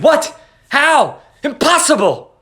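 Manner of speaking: sharp, high, stunned cries; distraught disbelief. A narrator's reading. Husband hearing the necklace is lost, stunned and high. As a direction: blurt each word in shock. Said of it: "He stood up distracted".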